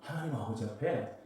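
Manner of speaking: ordinary delivery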